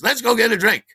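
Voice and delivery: high, loud party call. Retold or imitated. Geraldine as the song ends, imitated loud call. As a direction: imitate someone calling friends off the floor.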